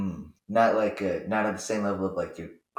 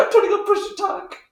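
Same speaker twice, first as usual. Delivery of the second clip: high squeaky mock voice; 4 dB louder than usual. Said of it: "I'd be like".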